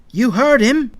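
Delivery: high, loud prod; loud, high urging; urgent order. A narrator's reading. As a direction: hurry them along forcefully. Hurrying friend away from battle.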